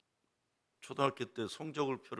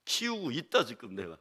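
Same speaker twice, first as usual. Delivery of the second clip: ordinary talk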